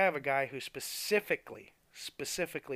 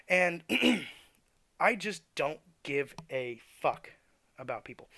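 ordinary talk, nothing marked

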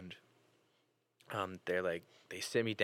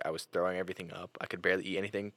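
ordinary talk

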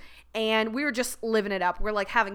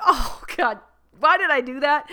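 louder, higher groan of regret; mock dismay; 5 semitones higher, 7 dB louder than usual. Driving to work, regretting the note, loud mock dismay. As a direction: cringe at her own boldness. Regretting her bold move next morning.

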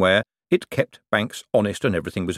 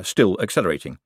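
ordinary delivery, nothing marked

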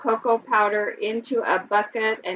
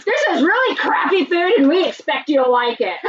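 mock ad slogan; 6 semitones higher, 4 dB louder than usual.

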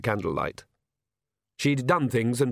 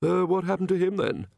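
higher start, dropping on the last word; sharp question; 7 semitones higher than usual. A narrator's reading. King questioning Nanny about the name, mildly sharp. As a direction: ask it with a touch of sharpness. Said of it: "said the king sharply".

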